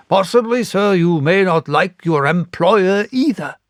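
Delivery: a stung, sarcastic sting; huffy squire. A narrator's reading.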